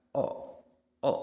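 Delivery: "O" voiced from the base of the throat; vowel o demo. Shown as a demonstration. Teacher showing students the throat vowel. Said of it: "kita ucapkan".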